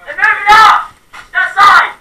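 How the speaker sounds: shouted high and loud warning; urgent shout. Real, not acted. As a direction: yell it across the field. Warning team of enemy push.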